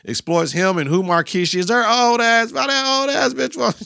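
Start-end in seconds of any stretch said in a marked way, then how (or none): 1.7-3.8 high, indignant mock shriek; jealous girlfriend. LaWanda raging at Markeisha, high scandalized mock voice. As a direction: go way up, scandalized and petty.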